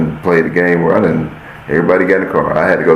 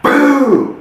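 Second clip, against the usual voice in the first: shouted boom. Retold or imitated. Him slamming the dunk down, high shouted boom. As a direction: slam the word out like the dunk.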